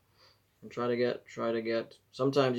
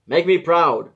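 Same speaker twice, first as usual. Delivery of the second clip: loud, higher fatherly send-off; playful dad-style pep talk; proud dad; 6 semitones higher, 14 dB louder, slower than usual. Pretend. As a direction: cheer them on like a dad. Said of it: "feeling like a dad here".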